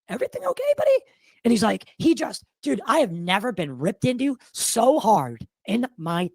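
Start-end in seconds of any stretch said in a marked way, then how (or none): 0.1-1.0 much higher, careful buddy tone; gentle check-in. Retold or imitated. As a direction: ease into it like checking on a friend. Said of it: "and I'm like".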